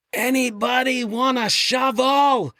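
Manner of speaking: high, slow call from up on the cliff. Retold or imitated. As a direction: stretch it out like a shout from above. Martin calling from the cliff.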